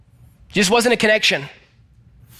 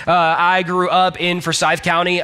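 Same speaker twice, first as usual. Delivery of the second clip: ordinary talk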